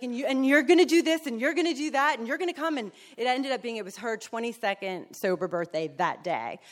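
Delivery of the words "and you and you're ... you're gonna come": high, loud, fast bossy sponsor. Retold or imitated. Sponsor lists the newcomer's duties, high and fast. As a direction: rattle off orders gleefully. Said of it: "she's like".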